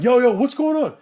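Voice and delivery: loud, high shout across a scuffle; urgent street holler; retold alarmed call. Calling out at fight, retold.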